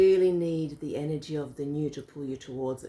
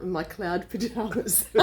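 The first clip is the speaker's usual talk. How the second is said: ordinary delivery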